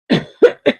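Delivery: short, loud cough; quick real throat-clearing cough. Real, not acted. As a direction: cough out sharply. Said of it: "Excuse me".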